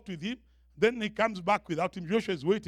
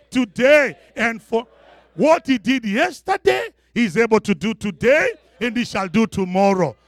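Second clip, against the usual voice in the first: emphatic, ringing sermon delivery; full-force preaching; 3 semitones higher, 7 dB louder than usual. Real, not acted. Preacher urging the church that God is the same, full-force preaching. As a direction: preach it out, driving each phrase home.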